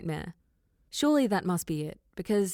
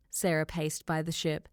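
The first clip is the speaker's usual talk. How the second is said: usual speaking voice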